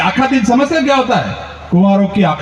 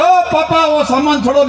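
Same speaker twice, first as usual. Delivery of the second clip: calling out; 7 semitones higher than usual. Retold or imitated. Calling out to father.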